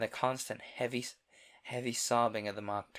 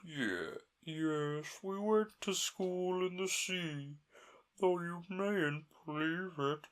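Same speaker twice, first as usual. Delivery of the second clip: high, slow, mournful turtle; slow, sorrowful old turtle; Mock Turtle voice; 6 semitones higher, slower than usual.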